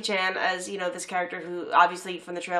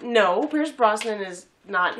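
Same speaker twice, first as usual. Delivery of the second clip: slow insistent; 4 dB louder, much slower than usual. Retold or imitated. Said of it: "He was like".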